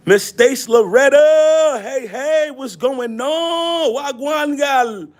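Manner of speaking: high, drawn-out hollered greeting; excited shout-out. Real, not acted. Streamer greets a viewer joining the live, excited shout-out. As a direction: stretch each word into a singsong call.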